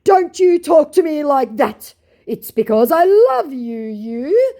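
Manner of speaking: high, loud, slow mock indignation; hammed-up lover's scolding, ending on a query; indignant scammer. Scammer scolds her for her tone, mock-indignant lovesick voice. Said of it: "To which my scammer said".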